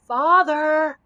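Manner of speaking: high, loud drawn-out scream. A narrator's reading. Fallen giant calling for his father, screaming. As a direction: cry out loudly for help. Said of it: "screamed the giant".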